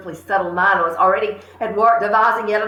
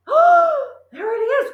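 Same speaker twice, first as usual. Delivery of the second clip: high, slow frightened cry; scared cry; 3 dB louder, much slower than usual. Acted. Brother scared by the parrot's voice, crying out high. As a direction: cry out in fear. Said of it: "cried the brother".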